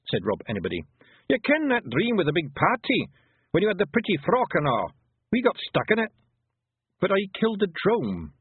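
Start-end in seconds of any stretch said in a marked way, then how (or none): 1.3-8.3 high, lively character voices; animated higher-pitched dialogue; Feegle and girl arguing. Acted. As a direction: voice an excuse and a protest. Feegle leader and girl argue.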